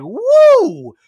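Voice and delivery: loud high whoop; excited whoop. Retold or imitated. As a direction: cheer like you just saw food. Excited at Taco Bell menu.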